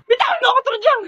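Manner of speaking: a classmate screaming at Turjang to let go of her; furious shout. Acted. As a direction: yell to be let go. Grabbed during a fight.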